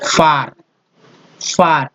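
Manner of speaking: फार said with a long open aa; pronunciation demo. Shown as a demonstration. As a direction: stretch the आ in फार. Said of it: "यहाँ ए को आ पढ़ रहे हैं". (फार में लंबा आ)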